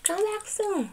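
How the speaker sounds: high, loud farewell call; calling out goodbye. Acted. Clerk to departing customer, calling out goodbye.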